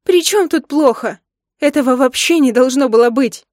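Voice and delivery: loud, high-pitched protest; indignant outburst. A narrator's reading. Wife tells husband it should never have happened, indignant outburst. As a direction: object hotly at full voice.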